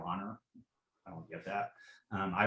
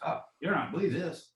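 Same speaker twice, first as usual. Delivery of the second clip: hammed-up country accent, pitch leaping up; mocking country accent; 8 semitones higher, 4 dB louder than usual. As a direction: sneer it in an overdone country accent. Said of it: "you know, country, and in a countryest way".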